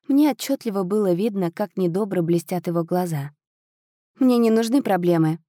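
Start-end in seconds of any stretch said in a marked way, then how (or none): none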